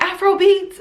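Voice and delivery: high, brightly pitched mock reply; imitating the waitress. Imitating the taco waitress, high mock voice. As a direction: squeak it up like the waitress answering. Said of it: "And she's like".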